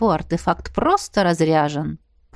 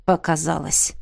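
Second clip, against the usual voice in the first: low, dismissive grunt; grumbled; 3 semitones lower than usual. A narrator's reading. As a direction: grunt it offhand. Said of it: "буркнул Весоуран". (недовольно буркнуть)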